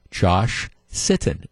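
"Josh... Sitton", low and deliberately slow; stop and say. Shown as a demonstration. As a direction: stop after Josh, then enunciate Sitton clearly. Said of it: "you don't stop and say".

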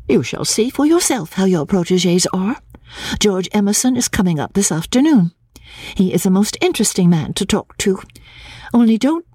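high, animated retort; heated reply. A narrator's reading. Lucy defending George to Cecil, heated reply. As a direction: answer back high and eager.

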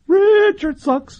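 high, slow, drawn-out gleeful declaration; mock proclamation. Gleefully echoing a listener text.

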